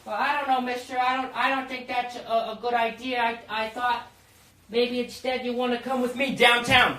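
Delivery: high, hesitant mock child voice; little boy. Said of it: "Hey, little boy".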